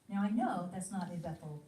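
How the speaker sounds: ordinary talk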